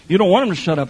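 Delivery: normal talking voice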